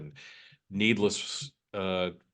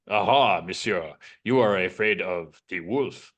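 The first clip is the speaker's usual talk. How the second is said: put-on hammy French Canadian villain accent; 5 dB louder than usual. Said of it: "worst French Canadian accent I have ever heard".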